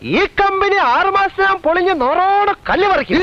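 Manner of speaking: rally speech. Acted. Fired workers protesting outside.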